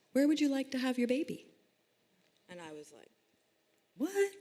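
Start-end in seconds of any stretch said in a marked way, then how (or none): 0.1-1.5 lightly voiced student midwife, pitched up; a higher-pitched imitation of the midwife's question; young student midwife. Student midwife asks a pregnant client, lightly imitated in higher pitch. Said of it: "asked by this young student midwife". 4.0-4.3 high-pitched startled squeak with a laugh; astonished. Retold or imitated. Pregnant client reacts to the midwife's question, astonished.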